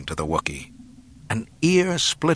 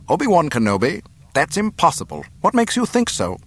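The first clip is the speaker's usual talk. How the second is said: high, louder disbelief; startled; 8 semitones higher, 4 dB louder than usual. A narrator's reading. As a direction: react with startled incredulity. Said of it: "Tarkin looked startled".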